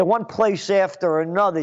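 usual speaking voice

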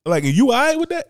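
high, loud disbelieving question; incredulous. Real, not acted. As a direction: ask it in plain disbelief. Incredulous challenge to listeners.

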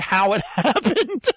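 words breaking into laughter; amused, losing it on the last word; cracking up. Real, not acted. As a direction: laugh helplessly as the sentence ends. Cracks up at own story.

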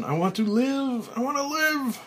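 high, loud desperate yell, rising further; desperate shout. Acted. Buried man pounding the lid, screaming to live. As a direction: scream it for your life. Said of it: "Frank's pounding increases".

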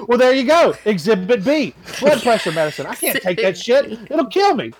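excited protest. Retold or imitated. Driver pressing his case to the cop, high and excited. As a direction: argue it like you've won. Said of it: "And I was like".

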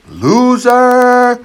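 high, loud stadium heckling; hollering insults from the stands; jeering fans yell. Retold or imitated. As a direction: bellow it like a jeering crowd. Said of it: "they're yelling".

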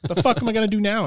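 frantic, at a loss; panicked exasperation. Retold or imitated. Stuck at the island's end, loud high panic. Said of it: "I was like".

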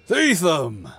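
roared greeting, high and loud; booming warrior king. Acted. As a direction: bellow the name in joyful welcome. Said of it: "roared the king".

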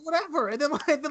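ordinary talk

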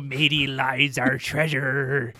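slow hammy pirate growl. Retold or imitated. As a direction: ham it up like a stage pirate. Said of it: "puts on his pirate voice".

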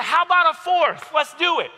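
high, loud, reckless dare; defiant comeback. Retold or imitated. Student outbidding the teacher's check marks, loud defiant dare. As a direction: shout back, upping the stakes.

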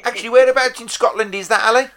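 normal talking voice

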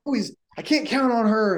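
high-pitched, exasperated mock complaint; confused and frustrated. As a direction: play a coach fed up with a player. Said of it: "you're gonna be just confused and frustrated".

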